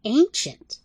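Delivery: lightly respectful squeaky rat. Acted. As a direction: say it with reverence. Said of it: "then added respectfully".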